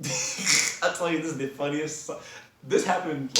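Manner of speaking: high, slow words through laughter; real laughing. Real, not acted. Storyteller reliving the funniest moment, high and laughing. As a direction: struggle to speak through the giggles. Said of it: "I'm laughing as if this was yesterday".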